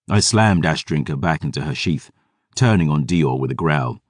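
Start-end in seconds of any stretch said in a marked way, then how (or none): none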